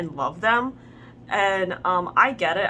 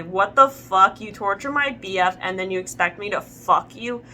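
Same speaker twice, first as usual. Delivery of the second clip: usual speaking voice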